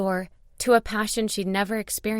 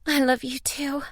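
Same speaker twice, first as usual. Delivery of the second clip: higher-pitched heroine's loving answer; loving reply; 6 semitones higher than usual. A narrator's reading. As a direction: answer his love in a lifted voice.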